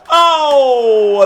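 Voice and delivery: loud, high "oh" at the miss; surprised groan. Real, not acted. Player watching a near miss, loud surprised oh. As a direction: cry out as it hits the post. Shot hits the post.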